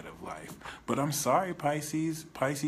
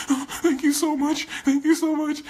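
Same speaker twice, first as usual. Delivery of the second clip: very high, loud squealing gratitude; overjoyed healed man; gushing thanks; 11 dB louder than usual. Retold or imitated. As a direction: squeal your thanks like a giddy fan.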